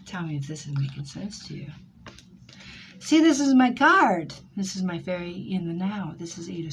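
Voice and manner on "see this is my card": high, loud burst; excited exclamation. Real, not acted. Reader shows client her card, excitedly loud. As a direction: exclaim it like proof found.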